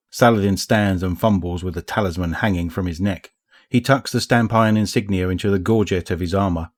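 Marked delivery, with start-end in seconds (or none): none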